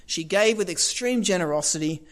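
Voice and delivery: ordinary talk, nothing marked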